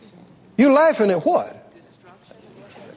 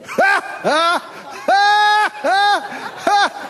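high, spaced-out mock belly laugh; hearty acted laughter; 7 semitones higher, much slower than usual. God breaking out into laughter before the throne, high acted laugh. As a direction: burst into big put-on ha-has. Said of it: "God breaks out into laughter".

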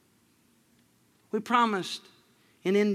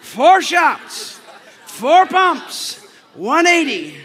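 much louder, higher shouted call; shouted order; 7 semitones higher, 11 dB louder, much slower than usual. Shown as a demonstration. Preacher leading the congregation to shout his coffee order. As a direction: belt it like a rallying cry. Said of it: "Everyone say that".

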